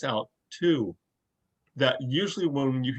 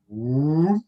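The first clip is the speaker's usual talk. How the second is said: long rising boom; imitated seismic charge; 4 semitones higher, 4 dB louder than usual. Fan imitates favorite Star Wars sound effect, drawn-out rising boom. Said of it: "favorite sound effect of all time".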